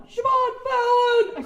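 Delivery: huge, high, slow scream; screamed own name. Retold or imitated. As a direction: scream your name so everyone hears it. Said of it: "I screamed my own name".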